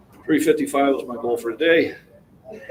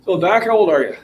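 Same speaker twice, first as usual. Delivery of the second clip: raised voice, higher pitch; calling out; 6 semitones higher, 8 dB louder than usual. Real, not acted. Gym-goer asking Doc his age, calling out. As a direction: call the question over to someone.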